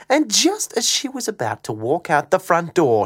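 ordinary delivery, nothing marked

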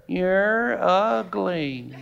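parrot voice. Acted. Parrot to woman on third day, slow soft taunt. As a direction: draw it right out like a parrot.

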